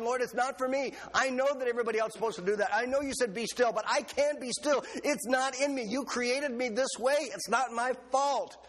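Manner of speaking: high, whiny grumbling at God. Retold or imitated. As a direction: grumble high like a stubborn child. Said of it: "and I'm grumbling".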